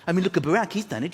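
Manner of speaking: ordinary talk, nothing marked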